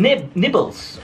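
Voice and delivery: ordinary talk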